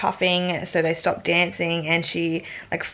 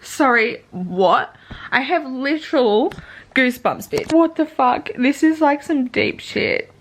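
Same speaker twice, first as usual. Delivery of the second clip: louder and higher, worked up; giddy, outraged reaction to a plot twist; stunned excitement; 6 semitones higher, 4 dB louder than usual. Real, not acted. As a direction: blurt it out in shock and excitement. Reacting to the passage she read.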